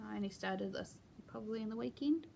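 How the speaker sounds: ordinary talk, nothing marked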